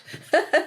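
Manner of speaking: quick laugh, high pitched; bright high laugh. Real, not acted. Guest laughing at her own point, a high laugh. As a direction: burst out in a short laugh.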